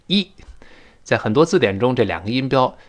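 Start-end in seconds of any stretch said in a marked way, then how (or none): 0.1-0.3 "一" as a clear ee sound; word-final ee. Shown as a demonstration. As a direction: demonstrate the final vowel as "一", ee. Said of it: "诶要变成一".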